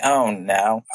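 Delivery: low, mock-horrified groan; a hammed-up horrified oh no. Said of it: "He's horrified".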